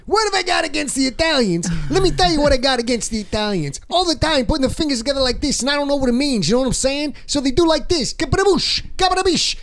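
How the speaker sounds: high-pitched hammed-up Italian accent; cartoonish Italian, high and excitable; fake Italian accent. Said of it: "the fake Italian accent".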